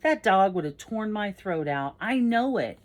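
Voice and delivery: put-on emphatic, worked-up boy; dramatic insistence. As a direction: swear it as if you barely escaped. Andy insists the dog would kill.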